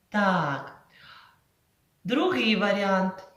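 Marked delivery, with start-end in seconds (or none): none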